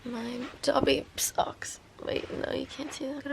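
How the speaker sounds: breathy early-morning whisper. Real, not acted. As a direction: keep it to a hush.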